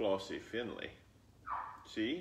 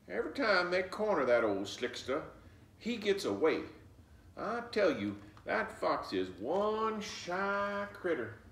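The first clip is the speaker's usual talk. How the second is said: higher, louder folksy grandma, slowing at the end; grandmother voice; 9 semitones higher, 5 dB louder than usual. Acted. Grandmother telling Flossie about the sly fox, folksy. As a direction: tell it like a wise old grandma.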